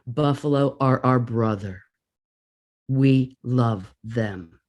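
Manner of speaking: lightly voiced deep male voice speaking in her head. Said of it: "the voice again".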